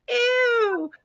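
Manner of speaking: high, drawn-out cry of disgust; loud mock toddler squeal; imitating little girl. Mom mimics daughter in last row by bathroom, loud disgust. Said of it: "And here's Chloe".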